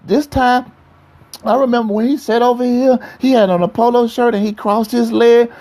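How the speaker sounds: high, dreamy put-on girlfriend voice; mocking girlfriend voice. Preacher imitating a woman reminiscing about her ex, high and dreamy. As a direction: gush over the old boyfriend, pitched high.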